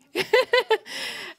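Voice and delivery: high embarrassed nervous laugh. Real, not acted. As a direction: laugh off the nerves. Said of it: "I'm a little nervous".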